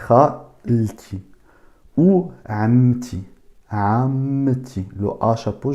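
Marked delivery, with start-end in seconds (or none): 2.0-4.9 amti with an open A at the start; open A sound. Shown as a demonstration. Teacher modelling the A of amti for students, slow. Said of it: "je la prononce A".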